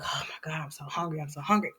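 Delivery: hushed, low complaint of hunger; weakly hungry fox. Acted. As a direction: half-whisper it, faint with hunger.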